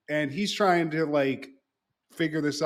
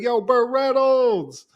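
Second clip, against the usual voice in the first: 1 excited greeting; 8 semitones higher, 4 dB louder, slower than usual. Retold or imitated. Martin greets Burt Reynolds loudly.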